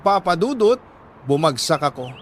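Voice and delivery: ordinary talk, nothing marked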